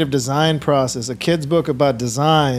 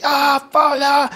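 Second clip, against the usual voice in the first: much higher, louder mock cry of pain; imitated pained outburst; 11 semitones higher, 4 dB louder, slower than usual. Dad acting out the angry reaction he avoids, pained yelp. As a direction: yelp like you just got kicked. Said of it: "What do you wanna react".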